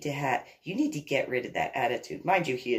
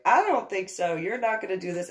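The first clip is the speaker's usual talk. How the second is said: higher, firm pushback; bucking up, defiant; 7 semitones higher than usual. Retold or imitated. Homeowner refusing the intruder in her yard, defiant. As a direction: stand your ground, voice raised in pitch. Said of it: "so I buck up".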